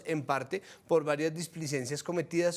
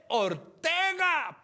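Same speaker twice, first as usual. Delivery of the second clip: higher, drawn-out incredulous name; incredulous outburst; 10 semitones higher, much slower than usual. Real, not acted. Host appalled by the ambassador's march, drawn-out incredulity. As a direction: stretch the name out in disbelief.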